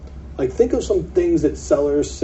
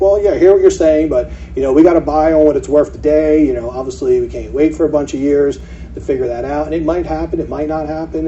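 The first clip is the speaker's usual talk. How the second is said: put-on old pushy self; 2 semitones higher, 7 dB louder than usual. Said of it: "the old me totally would have been like".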